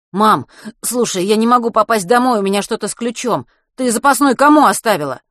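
loud, high-pitched anxious appeal; urgent blurting. A narrator's reading. Boy blurting his key trouble to mum, urgent. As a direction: blurt it out before she can interrupt. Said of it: "Скороговоркой выпалил Матвей".

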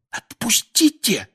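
put-on boy being throttled, gasping to be let go; wheezing. A narrator's reading. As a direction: squeeze it out through a choked throat. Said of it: "просипел Гарри".

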